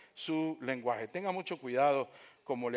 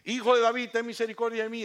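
raised, high insistent plea; imitated crying out; 7 semitones higher, 5 dB louder than usual. Blind man begging Jesus for mercy, insistent mild shout. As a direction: call out over and over, urgently. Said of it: "el ciego está gritando".

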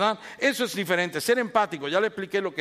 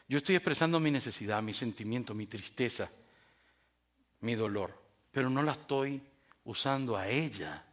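ordinary delivery

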